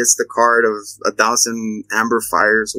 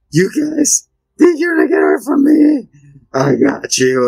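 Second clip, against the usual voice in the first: menacing clown shout; 5 semitones higher than usual. Retold or imitated. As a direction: belt it like a gloating monster. Pennywise voiced in a recap.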